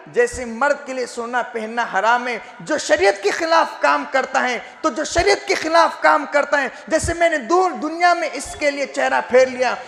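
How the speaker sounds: high, insistent sermon warning; impassioned preaching. Retold or imitated. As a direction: quote the warning with a preacher's fervour.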